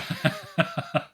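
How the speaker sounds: high little giggle; short, high string of giggles; character giggle. Acted. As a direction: giggle as a tiny mischievous girl. Hope's giggle before she speaks.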